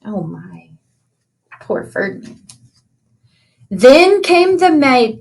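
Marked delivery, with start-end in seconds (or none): 0.0-2.4 hushed, breathy, much softer pity; pitying sigh. Acted. Reader to children, hushed pity for the bull. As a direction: whisper your sorrow for him.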